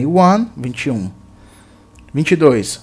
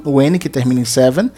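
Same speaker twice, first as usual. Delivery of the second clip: usual speaking voice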